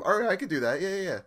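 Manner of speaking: lightly voiced restaurant clerk. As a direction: play the person taking a pizza order. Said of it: "like a normal person who answers the phone".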